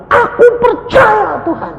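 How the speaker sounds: high, loud wail of faith; mock tearful shout; imitated crying. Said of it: "Nangis kayak anak kecil".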